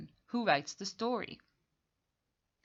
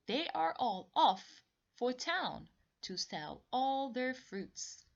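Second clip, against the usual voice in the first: lifted, higher storybook voice; story reading; 6 semitones higher than usual. Acted. Teacher reading the story aloud, voice lifted high. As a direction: bring the page to life for the kids.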